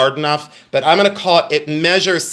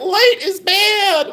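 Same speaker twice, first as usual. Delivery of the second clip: very high, slow creature whine; Skeksis; slower than usual. Pretend. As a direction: wail it slowly in a put-on creature voice. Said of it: "and they were like".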